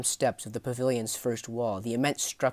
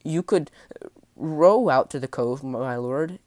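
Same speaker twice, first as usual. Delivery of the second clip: high, hesitant, loud appeasing voice; nervous sailor; 4 semitones higher, 8 dB louder than usual. Acted. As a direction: offer it timidly, faltering. Frightened sailor offers a compromise.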